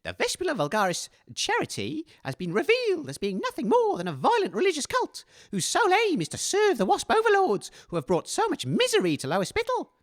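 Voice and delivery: ordinary talk, nothing marked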